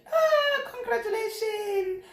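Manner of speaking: high, teary laugh-cry of joy; aunt overjoyed, laughing and crying at the pregnancy news; laughing through tears. Retold or imitated. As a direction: gush congratulations while half laughing, half crying. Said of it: "My aunt was like".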